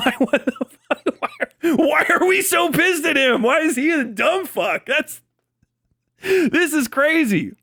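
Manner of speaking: can't believe what you're seeing, voice climbing; rising, incredulous laughter in the voice; incredulous outburst. Real, not acted. Incredulous at the hate for FD.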